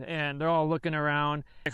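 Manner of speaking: normal talking voice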